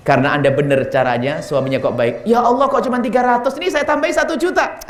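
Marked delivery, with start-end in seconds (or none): 2.3-4.8 higher, eager generous husband; delighted husband. Retold or imitated. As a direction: gladly top up the gift. (suami yang gembira menambah uang untuk ibu mertua, nada tinggi)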